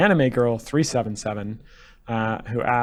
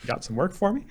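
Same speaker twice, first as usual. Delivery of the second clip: Raynor voice; 6 semitones higher, 4 dB quieter than usual. Retold or imitated. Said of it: "the voice of Raynor".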